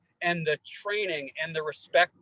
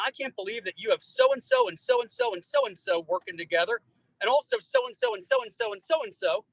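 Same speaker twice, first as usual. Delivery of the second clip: excited friend retelling; 11 semitones higher than usual. Retold or imitated. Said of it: "last night I was on a volunteer conference call".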